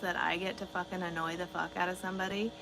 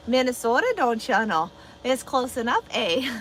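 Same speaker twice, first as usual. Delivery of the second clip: mock Minnesota accent; 4 semitones higher, 9 dB louder than usual. Jokes about Minnesota vs Canada.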